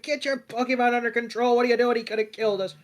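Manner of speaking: mock angry scolding, pitched high; pissed off. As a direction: tell off a careless trainer, quick and high. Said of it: "and Tracy's pissed".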